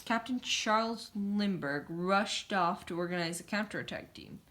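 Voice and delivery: ordinary delivery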